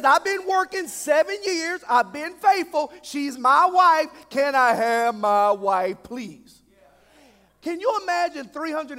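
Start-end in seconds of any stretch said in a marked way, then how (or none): 0.0-4.1 loud, high, insistent protest; worked-up claim of what is owed; exasperated pleading. Acted. Acting Jacob arguing his case. 4.3-6.6 slow, drawn-out plea, dropping away at the end; laboured, insistent asking; exasperated request. Acted.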